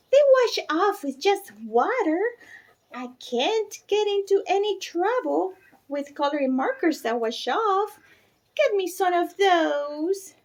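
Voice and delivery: put-on high, pleading little girl voice; a small daughter nagging her mother for markers; little girl begging. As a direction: whine for markers like a small child. Bridget argues with her mother.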